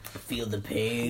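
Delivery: slow, soft spooky taunt; mock menace. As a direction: drawl it like a villain. Mock-menacing line to friend.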